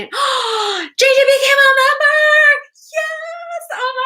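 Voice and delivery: gasp, then high slow sung-out shout; excited shout. Real, not acted. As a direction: shout the news in delight. New member appears on stream.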